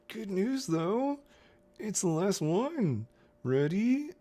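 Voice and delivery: high-pitched talking through the teeth; squeaky mock Capitol voice through closed teeth; jaw-closed Capitol voice. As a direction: pipe it very high with the jaw held shut. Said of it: "talk with my teeth, you know, jaw closed".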